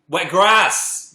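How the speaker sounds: loud excited. Real, not acted. Excited reading of the words.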